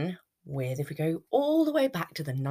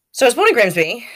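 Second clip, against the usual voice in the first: emphatic restart; 8 semitones higher, 13 dB louder, faster than usual. Real, not acted. Restarts story loudly.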